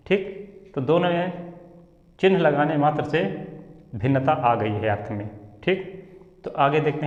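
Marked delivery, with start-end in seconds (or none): none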